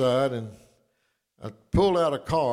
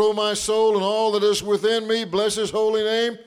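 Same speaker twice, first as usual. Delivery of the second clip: raised, ringing psalm reading; scripture reading; 7 semitones higher, 4 dB louder than usual. Acted. Pastor reading the Psalm to his church, raised and ringing. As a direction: proclaim it like scripture from the pulpit. Reads Psalm 103 aloud.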